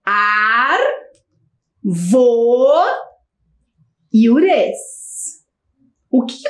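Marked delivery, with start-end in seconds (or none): 0.0-4.8 stretched syllables with long gaps between them. Shown as a demonstration. Teacher repeating syllables for pupils, syllable by syllable. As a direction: break the word apart slowly for children. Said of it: "Vou repetir".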